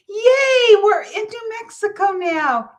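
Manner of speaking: put-on high, loud cheer of a little boy; excited child scream. Little nephew cheering at his aunt's farm, a high loud shout. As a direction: shout it like a kid who finally arrived. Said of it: "started screaming".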